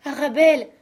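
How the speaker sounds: loud high wail of a name, falling; grieving father crying her name; anguished cry. A narrator's reading. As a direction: call out to the dead girl. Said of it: "cria-t-il".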